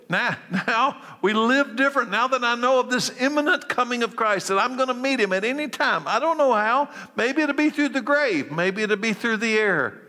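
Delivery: rising fervent preacher voice; impassioned preaching. Acted. Pastor stirring his congregation about Christ's return, fervent preaching. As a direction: preach it with growing fire.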